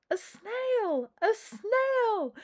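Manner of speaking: high, loud panicked cry; teacher shrieking in fright at a snail; alarmed shriek. Acted. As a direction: shriek at the sight of it.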